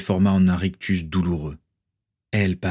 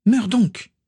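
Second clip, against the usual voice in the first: higher, louder, disdainful; haughty; 4 dB louder than usual. Acted. Tatiana rising over the dying Swazik, haughty. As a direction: look down on her and dismiss her. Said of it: "fit Tatiana hautainement".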